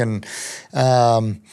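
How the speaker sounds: usual speaking voice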